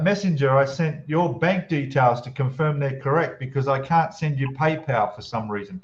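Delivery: ordinary delivery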